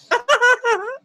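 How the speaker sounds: shrill mermaid giggle; high giggle. Acted. Mermaid sisters giggle at the redcap, very high.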